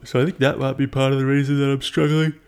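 talking through a yawn; stretched, yawning words. Real, not acted. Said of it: "I can't stop yawning".

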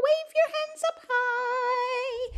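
slow, high sung instruction; high-pitched and drawn out. Shown as a demonstration. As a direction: sing it at the top of your range. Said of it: "as high as we can".

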